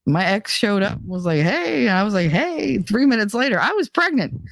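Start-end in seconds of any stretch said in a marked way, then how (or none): 1.4-1.9 flirty hey. Retold or imitated. Ex's flirty greeting. 2.3-2.8 flirty hey. Retold or imitated. Her flirty reply.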